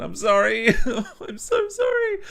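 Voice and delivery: high, laughing apology. Real, not acted. Reviewer sorry for his failed Irish accent, laughing. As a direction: crack up while apologising, pitch shooting up.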